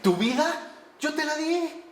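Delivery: usual speaking voice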